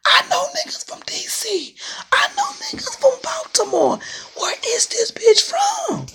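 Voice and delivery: very high whispered laugh-cry; laughing disbelief. Real, not acted. Mocking a rival to viewers, high whispered laughing disbelief. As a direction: squeak it out through laughter.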